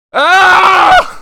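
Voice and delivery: sharp, high, loud yell of pain; loud frustrated scream. Real, not acted. Streamer screaming high and loud as he dies. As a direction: cry out as the character dies.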